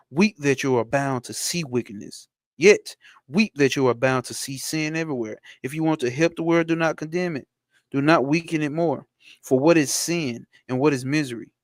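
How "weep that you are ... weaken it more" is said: usual speaking voice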